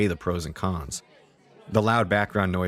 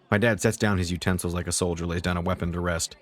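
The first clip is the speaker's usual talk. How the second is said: plain reading voice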